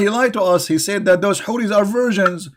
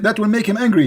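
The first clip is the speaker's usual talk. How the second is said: ordinary talk, nothing marked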